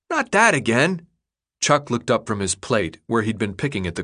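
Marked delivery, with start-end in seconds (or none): 0.1-1.1 put-on higher kid voice, fed up; exasperated boy. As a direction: groan at an old question. Chuck exasperated by the question.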